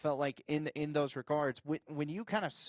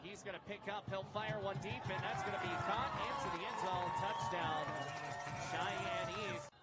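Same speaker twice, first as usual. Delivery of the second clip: high, shouted touchdown call, racing on the return; play-by-play call; 8 semitones higher, 10 dB quieter than usual. Acted. Host calling a pick return for viewers, shouted play-by-play. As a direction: yell it like the crowd is on its feet.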